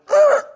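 a sudden loud gasp like slamming on the brakes; startled grunt. Retold or imitated. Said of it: "I came to one verse and I went".

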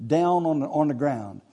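ordinary talk

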